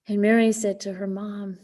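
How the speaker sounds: ordinary talk, nothing marked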